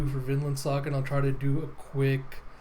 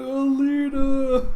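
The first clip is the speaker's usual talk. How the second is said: calling out; 11 semitones higher, 7 dB louder, much slower than usual. Acted. Teagle calls out to Alita.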